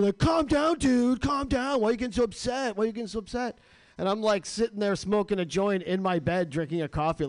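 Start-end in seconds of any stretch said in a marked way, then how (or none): 0.2-3.6 much higher, mocking calm-down voice; a smug troll saying calm down; mock gaslighting. Mocking the guy who reported him for bullying. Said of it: "he gaslights me".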